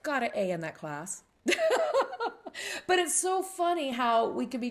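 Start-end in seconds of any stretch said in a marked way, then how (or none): 1.4-2.8 high delighted laugh; bright high burst of laughter. Real, not acted. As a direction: laugh with triumph. Laughing at getting an A.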